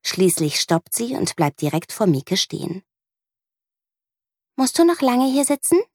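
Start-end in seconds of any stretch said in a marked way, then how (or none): 4.6-5.8 put-on high little girl's question; girl asking the one on the bench, high and curious; child asking. A narrator's reading. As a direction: ask it curiously like a small girl. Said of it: "fragt sie".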